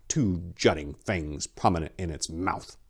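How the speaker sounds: ordinary delivery, nothing marked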